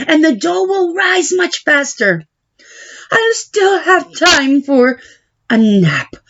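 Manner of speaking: a lazy fool talking himself into a plan; silly Big Anthony. Acted.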